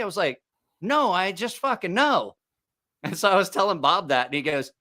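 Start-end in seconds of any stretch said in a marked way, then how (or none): 0.8-2.4 high, slow, certain insistence; slow high emphatic retort; insistent. Retold or imitated. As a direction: draw it out with total certainty. Insisting he knows.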